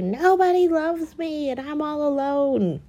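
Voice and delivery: mock whiny Spencer. Spencer moping about being alone, hammed-up whine. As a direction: whimper like a sulking kid. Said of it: "He's just like".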